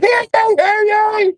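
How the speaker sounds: very high, loud mock herald shouting; hammed-up crier at the bow of the boat; town crier shout. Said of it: "Be Wasp on the front, like".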